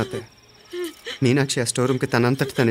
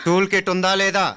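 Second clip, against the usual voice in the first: raised pitch, pressing hard for an answer; insistent demand; 6 semitones higher than usual. Acted. Brother interrogates the driver about the tool kit, insistent. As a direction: demand it, held high to the end. Same question repeated, pressing the servant.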